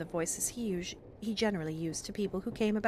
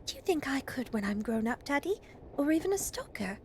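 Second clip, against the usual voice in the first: put-on hopeful child's question, rising high at the end; eager young girl; 6 semitones higher than usual. Roberta asks Daddy about growing up, eager and hopeful. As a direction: lift the voice into a child's hopeful plea.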